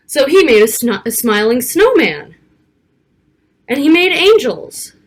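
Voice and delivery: lively picture-book read-aloud; reading to children. Acted. Narrator reading a snowy storybook to young children, lively. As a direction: read it like storytime for little kids.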